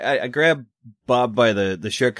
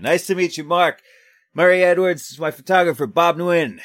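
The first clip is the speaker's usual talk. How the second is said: high, raised introductions; calling out in character; 8 semitones higher, 3 dB louder than usual. Acted. Reporter introducing himself and photographer under fire, calling out. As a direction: project it over the racket.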